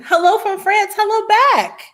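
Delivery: high, delighted hello; excited greeting. Real, not acted. Host greeting a viewer in France, excited and high. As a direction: sing out a big, high-pitched welcome.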